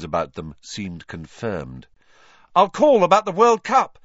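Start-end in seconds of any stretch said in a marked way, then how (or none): 2.6-4.0 much higher, louder call across a crowd; yelling after him. A narrator's reading. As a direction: shout it after a departing friend. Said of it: "Ron yelled after Harry".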